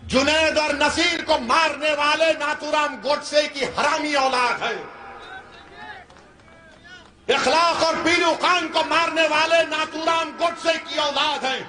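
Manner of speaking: hard-hitting stump speech; fiery rally oratory. Real, not acted. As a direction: denounce them to a big crowd, pitch held high.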